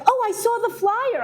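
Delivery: high, loud, eager caller voice; excited caller. Retold or imitated. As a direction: gush it like a thrilled fan.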